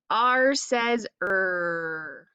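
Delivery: slow growling "er"; drawn-out er. Shown as a demonstration. Teacher modelling R sound, slowly. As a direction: stretch out "er". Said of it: "It's R".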